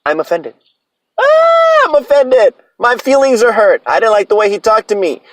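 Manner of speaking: ranting boss mocks offended young staff in a whiny voice; mocking whiny teen. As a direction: ham up a whiny offended kid complaining. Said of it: "their biggest fucking crutch".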